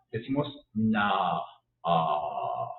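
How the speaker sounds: "na, ah" slow, low, open a sound; open vowel. Shown as a demonstration. Teacher showing the English o sound as an open a. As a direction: stretch "ah" with an O-shaped mouth. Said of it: "Pongo boca de O, pero digo la A".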